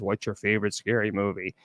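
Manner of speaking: low, soft phone-villain voice; imitated horror-movie caller, hushed and low; Scream caller. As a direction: quote the caller low and quiet. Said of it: "the Scream character calls this woman and says".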